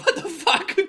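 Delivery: high-pitched, incredulous squeak; squeaky mock bafflement; baffled disbelief. Retold baffled reaction to story.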